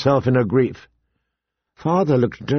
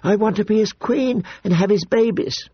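put-on higher, earnest girlish voice; young girl pleading; 6 semitones higher than usual. Daughter begging her father to accept her beloved, high and earnest. Sansa pleads love for Joffrey.

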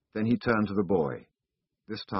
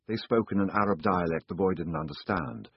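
usual speaking voice

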